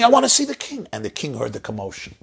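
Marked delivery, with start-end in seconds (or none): 0.0-0.9 a boy's retold scream, pitched much higher. Boy held by guards shouting to the king, in a high retold scream. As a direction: cry out high like a desperate child held back by guards. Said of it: "And he was screaming".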